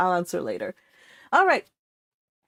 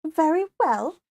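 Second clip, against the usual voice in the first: a hint of a haughty daughter, pitched up; lightly voiced Elizabeth; 7 semitones higher than usual. Elizabeth answering her sister Anne, lightly haughty and higher. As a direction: toss it off airily as the vain sister. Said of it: "said Elizabeth".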